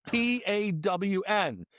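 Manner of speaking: high, slow, letter-by-letter spelling. Retold or imitated. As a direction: spell it out insistently for a confused listener. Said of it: "She goes".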